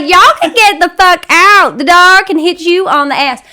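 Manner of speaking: loud Southern telling-off; high, angry Southern accent, hammed up; heavy Southern accent. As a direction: throw them out in a Southern twang. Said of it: "I go heavy on the Southern accent".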